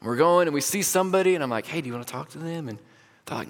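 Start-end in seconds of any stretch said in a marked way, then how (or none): 1.7-2.7 tentative prompting. Retold or imitated. Pastor nudging two students on the prayer walk, soft prompting. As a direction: ask softly, as if confiding.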